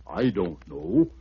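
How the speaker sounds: slow, low elephant voice; a lightly voiced elephant, shrugging.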